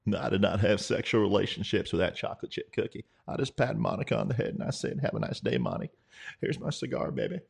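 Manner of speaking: hammed-up politician voice, dropping low by the end; mock president impression. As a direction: deny it like a slick politician, then croon low. Mock president denial and anecdote.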